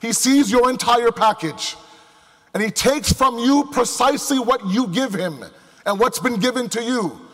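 normal talking voice

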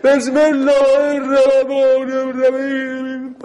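«بسم الله الرحمن الرحیم» stretched out slow and high, the wrong way to pray; sloppy prayer demo. Shown as a demonstration. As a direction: drag the prayer out lazily to show how not to do it. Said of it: "درست آدم اینجوری نماز بخونه؟". (نمایش غلط: «بسم الله الرحمن الرحیم» کشیده، کند و بالا)

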